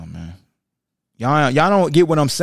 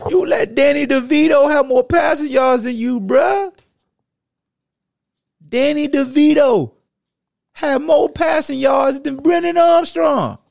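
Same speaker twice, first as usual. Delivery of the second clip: higher, louder shouting rant; outraged shout; 11 semitones higher, 4 dB louder than usual. Real, not acted. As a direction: yell at the quarterback. Yelling at quarterback's stats.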